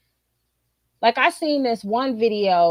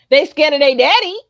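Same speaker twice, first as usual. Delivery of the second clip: loud; 4 semitones higher, 8 dB louder than usual. Real, not acted. Punchline about daddy.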